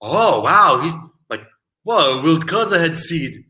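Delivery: ordinary talk, nothing marked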